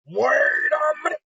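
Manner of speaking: high, slow, shouted panic; a drawn-out hammed-up yell of alarm; mock panicked shout. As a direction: let the realization explode out. Realization bursts into a shout.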